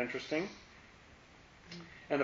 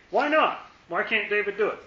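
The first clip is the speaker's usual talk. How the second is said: high, pointed questions; teacher challenging the class, high and pointed; rhetorical challenge; 6 semitones higher than usual. Real, not acted. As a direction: press them hard for the answer. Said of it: "teacher challenging the class".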